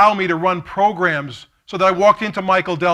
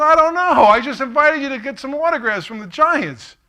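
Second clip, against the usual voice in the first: much higher, louder flustered protest; defensive buddy; 9 semitones higher, 6 dB louder than usual. Retold or imitated. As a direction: throw up your hands and protest your innocence. Buddy defends himself, second time.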